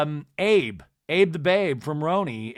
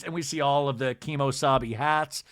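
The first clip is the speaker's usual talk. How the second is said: ordinary talk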